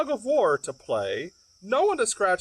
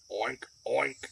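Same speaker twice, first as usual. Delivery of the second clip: pig oink; 6 semitones lower, 6 dB quieter than usual. Acted. Librarian hears pig.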